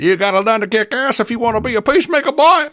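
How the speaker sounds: high-pitched, fast Louisiana sheriff rant; J W Pepper. Acted. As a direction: play a cantankerous Southern sheriff, hammed up. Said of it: "we knew J W Pepper".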